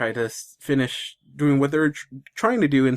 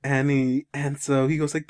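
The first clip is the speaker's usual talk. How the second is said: usual speaking voice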